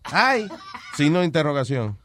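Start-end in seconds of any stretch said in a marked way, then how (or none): 0.1-0.5 mock texting voices. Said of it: "copy and paste".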